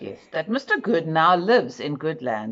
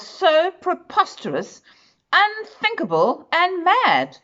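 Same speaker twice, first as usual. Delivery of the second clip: much higher, mock-outraged emphasis; incredulous rise; 11 semitones higher than usual. A narrator's reading. Narrator tells children how mad good deeds seem, in a high astonished voice. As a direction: exclaim how absurd it is.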